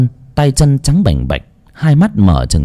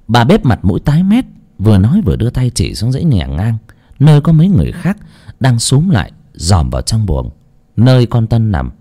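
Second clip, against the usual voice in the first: ordinary delivery, nothing marked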